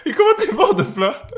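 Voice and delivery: high squeal of laughter in the words; giggling high question; laughing. Real, not acted.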